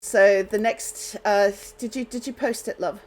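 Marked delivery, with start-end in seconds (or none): none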